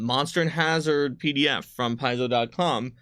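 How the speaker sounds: normal talking voice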